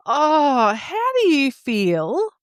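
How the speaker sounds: ordinary talk, nothing marked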